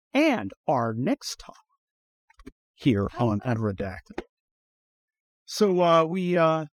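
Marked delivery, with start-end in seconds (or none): 0.1-4.2 slow, deep show-host segue; lightly hammed podcast intro; announcer segue. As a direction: announce the next topic like a radio host.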